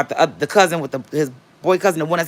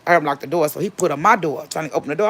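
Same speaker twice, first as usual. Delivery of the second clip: ordinary delivery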